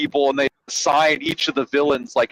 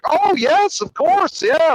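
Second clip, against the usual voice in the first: much higher, eager-to-please reply; sweetly obliging husband; 13 semitones higher, 3 dB louder, slower than usual. Retold or imitated. Phone-threatening villain answers his wife about lunch, sweetly obliging. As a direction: imitate a suddenly agreeable husband, voice pitched way up.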